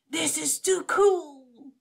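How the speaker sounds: high, slow thrilled shout; excited shout. A narrator's reading. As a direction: cry out in pure delight. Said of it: "shouted Oliver".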